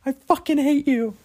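high, loud mock screech at Jeff; hammed-up wife in labour yelling; imitating his partner screaming in labour; mock birthing wife. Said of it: "You'll be like".

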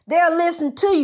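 normal talking voice

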